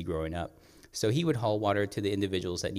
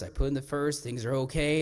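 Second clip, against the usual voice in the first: ordinary delivery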